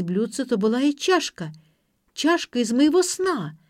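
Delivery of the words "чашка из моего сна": higher, louder startled exclamation; startled fright. A narrator's reading. Frightened girl recognising the cup from her dream, startled. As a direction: voice jumps up in alarm. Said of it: "испугалась девушка".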